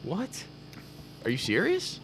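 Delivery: high, soft disbelieving what; surprised. Real, not acted. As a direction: caught off guard.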